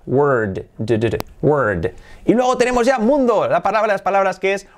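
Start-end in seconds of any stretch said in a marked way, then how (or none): none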